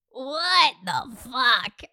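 very slow, stretched-out incredulity; slow, stunned exclamation; drawn-out disbelief. Real, not acted. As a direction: drag out every word in disbelief. Outraged reaction to her entertaining him.

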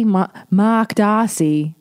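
slow, drawn-out mock-British delivery; Renée Zellweger's English accent. Imitating an actress naming the old suitor, English accent. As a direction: say it slowly in a put-on English accent. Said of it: "That's Renee Zellweger's English accent".

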